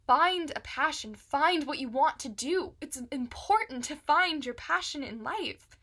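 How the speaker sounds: rousing motivational push; emphatic urging. Acted. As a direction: urge it forcefully, word by word. Urging listeners to find passion.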